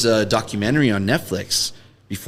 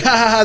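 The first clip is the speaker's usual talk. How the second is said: mock laugh; 11 semitones higher, 4 dB louder, much faster than usual. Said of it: "I'm like".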